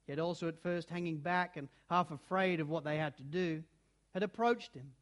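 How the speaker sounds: ordinary delivery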